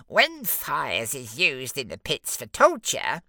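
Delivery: very high smug little imp; giggly sneering imp. Acted. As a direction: squeak it out smugly. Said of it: "the lead imp explained".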